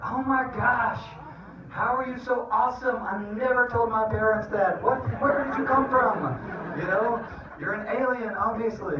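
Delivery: very high, astonished squeal; hammed-up gushing. Dad to son who said I love you, mock overjoyed amazement. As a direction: play amazed dad, voice shooting way up.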